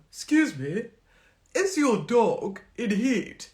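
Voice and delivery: "Excuse me" loud and slow, yelled across a field; loud, slow posh yell; shouted across a field. Retold or imitated. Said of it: "He shouted that across a field".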